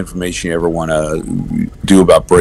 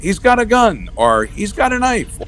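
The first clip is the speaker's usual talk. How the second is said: panicked elephant voice. Pretend.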